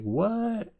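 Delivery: high, incredulous yelp; shocked. Retold or imitated. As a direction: blurt it out in mock shock. Acts shock at the price.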